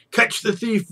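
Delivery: a sharp, high yell; shouted cry. Acted. Market crowd chasing a thief, high shout. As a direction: raise the alarm in the crowd. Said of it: "resounds aloud".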